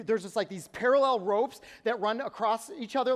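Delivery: ordinary talk, nothing marked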